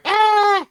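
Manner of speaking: very high loud yelp; high shriek. Retold or imitated. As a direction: shriek it as if the game thrilled you. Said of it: "Pumpkin Blaze was going".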